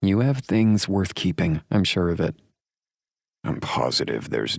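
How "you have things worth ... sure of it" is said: higher, confident woman; female character voice. A narrator's reading. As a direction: reassure him, pitched up. Heroine's dialogue.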